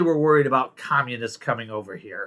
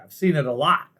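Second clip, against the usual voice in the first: normal talking voice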